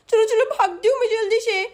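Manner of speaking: put-on high-pitched childish panic; small lisping girl urging herself to run; fleeing little girl. Little girl flees the thief.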